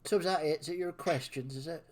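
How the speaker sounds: scornful West Country villain; mock them in a West Country sneer. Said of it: "doing a villain voice in West Country".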